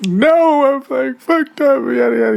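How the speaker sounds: very high-pitched panicked wail, played up; mock frantic. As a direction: squeal it in a hammed-up meltdown. Frantic reply to worried friend.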